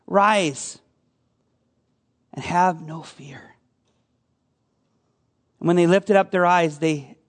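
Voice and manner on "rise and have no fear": 放轻声音，慢慢地，温柔地说"Rise and have no fear"，像在安慰人; 语气温和，让人安心; tender reassurance. Acted. Jesus' words spoken gently to the listeners.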